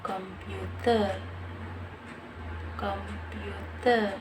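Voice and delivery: computer drawn out slowly with a Thai accent, no final r; slow Thai-accented computer, long last syllable. Shown as a demonstration. Learner shows how Thais pronounce the word, Thai accent. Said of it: "in Thailand accent".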